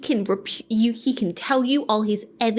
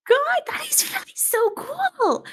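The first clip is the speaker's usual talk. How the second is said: starstruck gushing; 10 semitones higher, slower than usual. Acted. Dawn gushing in character.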